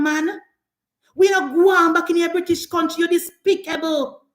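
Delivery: usual speaking voice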